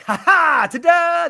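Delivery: loud, high ta-da with a put-on laugh; mock triumph. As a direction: crow it like a magician's reveal. Acted triumph at cleared name.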